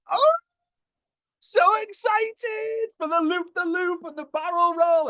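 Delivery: very high, drawn-out excited squeal; psycho thrill-seeker. Acted. Psycho rider loving the roller coaster, hammed-up excitement. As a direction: squeal with over-the-top glee. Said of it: "the other type, which looks like some of you psychos".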